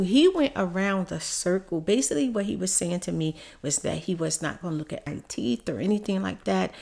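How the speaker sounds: ordinary talk, nothing marked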